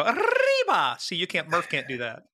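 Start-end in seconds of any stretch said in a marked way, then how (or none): 0.0-1.0 rolled-R shout. Shown as a demonstration. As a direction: belt out "Arriba!" rolling the R hard. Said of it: "I can roll my R's".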